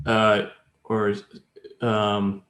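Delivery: ordinary delivery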